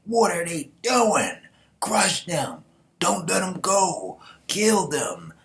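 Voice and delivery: high, loud furious battle shouts; enemy soldiers yelling. Acted. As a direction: yell orders at the enemy like a soldier gone mad. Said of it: "you got the Zhao people like".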